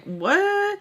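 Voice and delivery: high-pitched incredulous what; shocked disbelief. Retold or imitated. Woman told her friend never came, shocked. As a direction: let the question leap up high.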